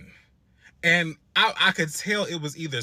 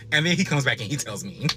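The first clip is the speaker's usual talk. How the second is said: ordinary talk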